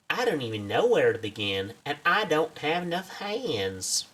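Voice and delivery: put-on eager field researcher with a southern accent. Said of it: "I gave you a southern accent".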